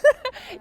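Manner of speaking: sudden loud, high burst of laughter. Real, not acted. Telling the host about hugs at the infusion center, bursting into laughter.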